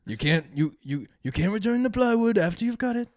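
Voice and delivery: Trump impression. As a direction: insist like a salesman. Trump plywood bit continues.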